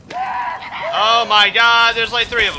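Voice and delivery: loud, high, drawn-out dismay; alarmed cry. Real, not acted. Player spotting three of them, alarmed cry.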